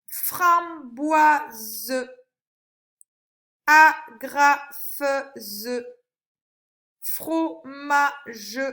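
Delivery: slow "Framboise", "Agrafeuse", each syllable held apart; teacher sounding out syllables. Shown as a demonstration. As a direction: split every word into separate, drawn-out syllables for a child. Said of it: "dans quelle syllabe tu entends le son feu".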